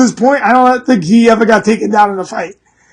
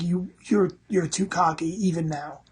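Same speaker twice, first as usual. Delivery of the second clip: softer, half-whispered warning from a god; Odin's whispered rebuke; 6 semitones lower, 16 dB quieter than usual. Retold or imitated. Odin rebukes the cocky fighter in a soft whisper. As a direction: hush it like a god's reproach. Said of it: "He's like".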